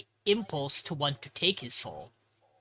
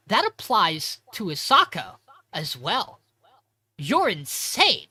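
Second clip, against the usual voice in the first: slow, high, louder disbelief; slow appalled; 4 semitones higher, 6 dB louder, slower than usual. Retold or imitated. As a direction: drag it out, appalled at him. Yoshiki horrified reply.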